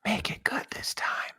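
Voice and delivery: secret whisper right in your ear. Pretend. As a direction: hush it close into his ear. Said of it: "I'm gonna whisper to Tony".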